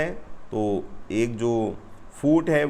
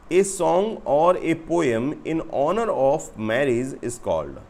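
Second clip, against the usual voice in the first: reading out a question; 3 semitones higher, much slower than usual. Real, not acted. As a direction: read the English slowly, phrase by phrase. Reads exam question aloud.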